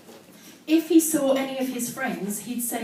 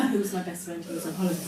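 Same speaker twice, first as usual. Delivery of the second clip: usual speaking voice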